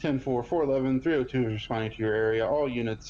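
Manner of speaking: usual speaking voice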